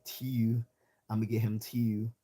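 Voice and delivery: mock Baltimore with "tiu" for two; exaggerated Baltimore "tee-oo"; Baltimore accent. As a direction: imitate the Baltimore fronted oo vowel. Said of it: "I don't say".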